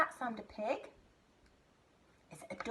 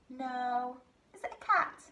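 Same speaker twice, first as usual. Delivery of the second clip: ordinary talk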